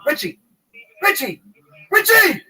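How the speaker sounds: high, loud calling out a name; repeated loud call. Retold or imitated. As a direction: call across the shop all day. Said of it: "All day long".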